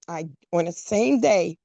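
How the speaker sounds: usual speaking voice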